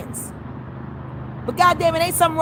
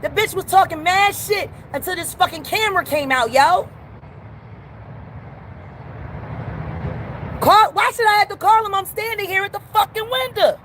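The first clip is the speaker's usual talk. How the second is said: higher, angrier complaint at the window; heated rant; 5 semitones higher than usual. Real, not acted. Customer at the window complains about staff, heated. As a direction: let them have it, fed up.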